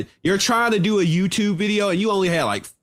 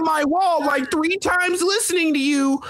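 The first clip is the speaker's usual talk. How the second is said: higher-pitched mock fury; mocking tough guy imitation; 7 semitones higher than usual. Man mimicking his opponent's tough talk, mocking. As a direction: parody a blustering internet tough guy. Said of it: "That's your tough guy impression".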